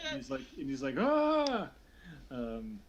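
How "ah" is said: loud, high cry of surprise; acted high-pitched startled yelp. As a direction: cry out as if caught off guard. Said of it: "he turns surprised to Salome".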